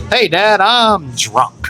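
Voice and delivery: high-pitched greeting, dropping at the end; mock-cheery announcement. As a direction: play the drunk kid announcing himself to Dad. Drunk teen greeting father at door.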